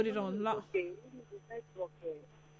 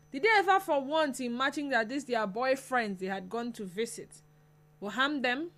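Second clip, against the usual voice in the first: ordinary talk, nothing marked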